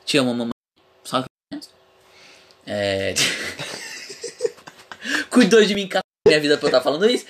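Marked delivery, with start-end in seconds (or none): 3.2-4.7 high breathless laugh. Real, not acted.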